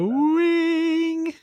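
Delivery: very high, drawn-out "Showing"; piercing falsetto tease; squeaky leer. Retold or imitated. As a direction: squeal it like a cartoon teen. Repeating the turtle gag.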